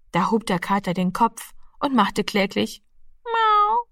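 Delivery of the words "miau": much higher plaintive cat meow; pitiful meow. A narrator's reading. Exhausted cat answering its name, pitiful meow. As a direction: mew like a hungry cat.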